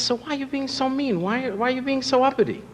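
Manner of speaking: hammed-up hurt complaint, high and soft; whiny Bush.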